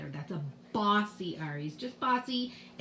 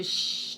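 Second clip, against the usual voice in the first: a drawn-out teacher's shush; hushing shh. Shown as a demonstration. Teacher explains how bossy R quiets vowels, hushing.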